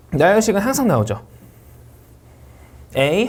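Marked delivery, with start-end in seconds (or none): 3.0-3.3 A lifted at the end; unfinished list item A, going up; rising list intonation. Shown as a demonstration. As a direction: raise A as if the list goes on. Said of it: "덜 끝났으면 올려 읽고".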